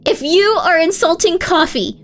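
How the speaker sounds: high, loud indignant tirade; hammed-up retold tirade; mock rage. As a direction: rail at the stranger as if defending family. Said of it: "I was really angry".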